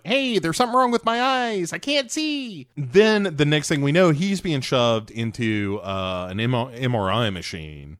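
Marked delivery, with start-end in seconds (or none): 0.0-2.7 panicked Cole. Retold or imitated. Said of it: "He's like".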